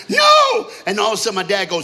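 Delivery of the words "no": loud, high mock wail; hammed-up tearful scream; crying out. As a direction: wail it in exaggerated horror. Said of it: "he starts crying".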